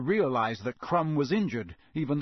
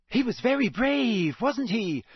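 put-on high, louder girl's voice; Hermione admiring; 8 semitones higher, 4 dB louder than usual. A narrator's reading. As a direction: exclaim with earnest admiration. Girl admires injured player.

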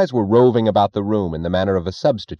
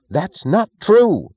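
much higher, louder and slow protest; crying out; 12 semitones higher, 6 dB louder, much slower than usual. A narrator's reading. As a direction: burst out in alarmed denial. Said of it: "he cried".

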